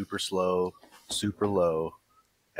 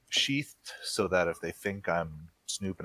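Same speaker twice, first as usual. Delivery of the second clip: ordinary talk, nothing marked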